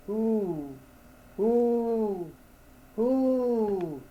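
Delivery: high, drawn-out owl hoot. A narrator's reading. Owl answering the lost man, high hooting. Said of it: "says he".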